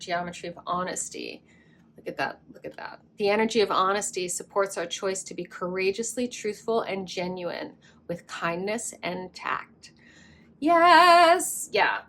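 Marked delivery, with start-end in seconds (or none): 2.0-3.0 quick hushed whisper of wonder; awed whisper. Real, not acted. Reader showing the honesty card, awed whisper. 10.6-11.7 high, loud burst of delight; excited yes. Real, not acted. Reader thrilled by the card, excited shout.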